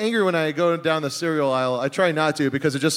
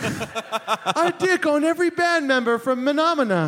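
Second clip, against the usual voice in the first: high, slow Shakespearean wonder; old-fashioned grand voice; 8 semitones higher, slower than usual. Retold or imitated. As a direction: declaim like a stage actor. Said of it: "she was like".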